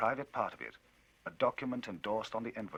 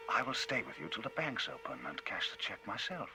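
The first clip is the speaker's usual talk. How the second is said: easy and sneering; 5 semitones higher than usual. Acted. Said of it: "quite easy and sneering".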